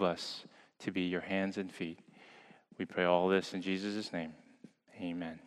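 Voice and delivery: soft, hushed pastoral prayer. Real, not acted. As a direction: pray quietly and reverently. Pastor prays before the sermon.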